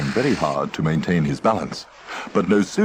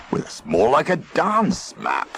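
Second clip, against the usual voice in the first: grizzled; 6 semitones higher than usual. A narrator's reading. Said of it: "grizzled Rhubarb".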